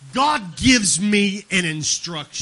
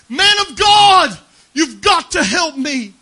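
high, loud cry for help; desperate plea; 8 semitones higher, 9 dB louder, slower than usual. Acted. Widow pleading with the prophet of God, crying out high and loud. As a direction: beg the prophet in anguish.

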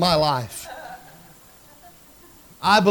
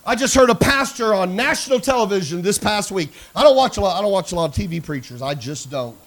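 usual speaking voice

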